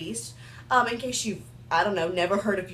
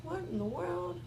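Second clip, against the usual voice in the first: muttered; 10 dB quieter than usual. Real, not acted. Muttered aside at lip gloss.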